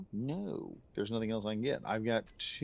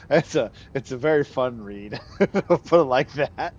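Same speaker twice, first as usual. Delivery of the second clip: loud, higher, talking through laughter; laughing; 4 semitones higher, 12 dB louder than usual. Real, not acted. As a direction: let the giggles break into the words. Giggling over a funny letter.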